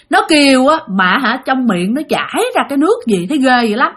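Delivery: plain reading voice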